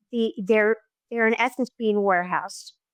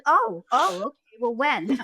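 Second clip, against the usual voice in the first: high "oh, oh", then dropping small; comic taken aback; 3 semitones higher than usual. Retold or imitated. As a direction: imitate mother's deflated comeback. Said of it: "then we go to humor".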